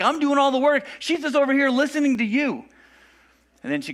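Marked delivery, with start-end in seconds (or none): 0.0-2.7 high-pitched put-upon complaint; annoyed Martha. Retold or imitated. Martha complaining to Jesus about her sister, high and annoyed. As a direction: whine it like the overworked sister. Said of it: "how exasperated, how annoyed she is".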